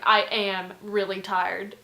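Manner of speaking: slow, loud, pointed reply; hateful retort. Retold or imitated. Roommate snubbing a chatty partygoer, slow hateful retort. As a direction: lean on each word with irritation. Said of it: "just being so hateful to this guy".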